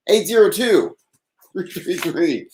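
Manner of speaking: normal talking voice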